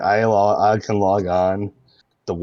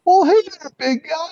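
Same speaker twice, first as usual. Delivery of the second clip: much higher, nerdy, annoying guy's voice; Nick Riley voice; 6 dB louder than usual. Acted. Nerdy Nick Riley greets his big buddy, voice pitched way up. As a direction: pitch way up into a goofy nerd greeting. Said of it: "It's the voice".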